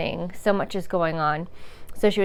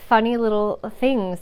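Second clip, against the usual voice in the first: ordinary talk, nothing marked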